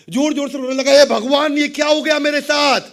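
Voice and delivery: high, loud cry to God; acted wail of a ruined man; loud wailing lament.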